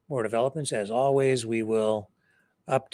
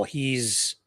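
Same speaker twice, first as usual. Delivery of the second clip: usual speaking voice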